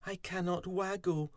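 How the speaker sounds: a sad little bee's complaint; mild moan; Fuzzy moaning. A narrator's reading. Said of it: "poor Fuzzy moaned".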